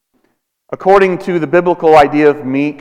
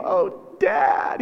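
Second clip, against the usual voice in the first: mock whiny cry; 10 dB quieter than usual. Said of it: "But he's not saying".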